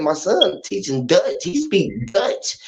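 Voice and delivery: slow, deliberate and hushed; mom explaining slowly. Retold or imitated. Mom to the school, slow and hushed. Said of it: "I said".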